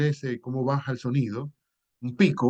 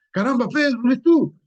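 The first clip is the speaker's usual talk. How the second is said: voz mucho más aguda y fuerte de amigo asombrado; amigo sorprendido; 12 semitones higher, 7 dB louder than usual. Retold or imitated. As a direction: exclamar como quien ve a un viejo amigo. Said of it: "uno reconoce al otro y le dice". (amigo que reconoce a otro en el metro, sorprendido)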